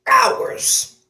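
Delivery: hours said high and breathy; stretched out. Retold or imitated. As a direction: drag out the word hours. Complaining about long downloads.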